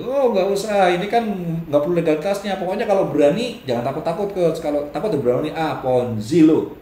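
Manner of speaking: mock Ponzi seller. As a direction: brush off the worry like a slick seller. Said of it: "Kalau tanya".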